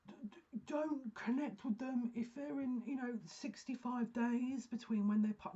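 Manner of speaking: lampooning rule-bound mediums, high and drawn out; mocking other mediums. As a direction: parody a fussy medium laying down rules. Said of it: "you get all kinds of mediums going".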